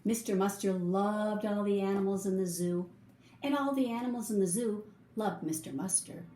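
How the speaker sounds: ordinary delivery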